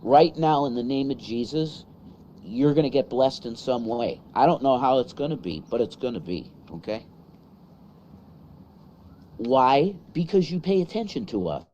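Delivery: loud preaching blessing; prayer. Acted. Prays blessing over viewers.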